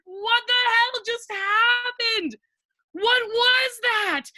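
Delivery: shocked laughter turning into questions; giddy disbelief. Retold or imitated. Laughing after being punched.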